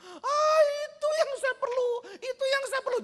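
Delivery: high, loud sobbing cry of relief. Retold or imitated. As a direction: burst into tears of relief. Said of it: "langsung dia nangis".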